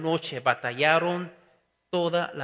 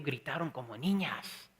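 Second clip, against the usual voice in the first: put-on teasing the kids; 4 semitones lower, 9 dB quieter than usual. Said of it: "cuando les digo la historia a mis hijos".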